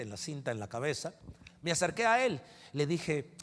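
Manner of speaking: ordinary talk, nothing marked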